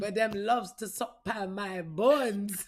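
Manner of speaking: put-on slow, loud Jamaican accent chicken voice; drawn-out Jamaican accent, hammed up. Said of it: "In a Jamaican accent?".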